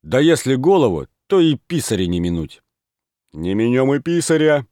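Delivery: loud, high eager cries; boisterous shouting. A narrator's reading. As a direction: egg each other on. Lads egg each other on.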